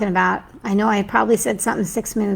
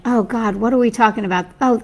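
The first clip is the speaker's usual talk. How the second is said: ordinary delivery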